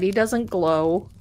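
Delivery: usual speaking voice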